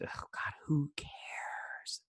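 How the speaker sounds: whispered aside, fed up; exasperated whisper. Real, not acted. Recapping a boring episode, an exasperated whisper. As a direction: breathe it out like you're over it.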